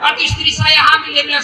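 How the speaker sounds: normal talking voice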